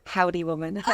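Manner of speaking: a light, mock Texas drawl; Texan drawl. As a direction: toss off a playful cowboy howdy. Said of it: "will you get a drawl".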